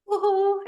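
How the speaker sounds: high, airy woo-hoo after a sigh; relieved whoop. Retold or imitated. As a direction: let out a high whoop of release. Voicing the release after crying.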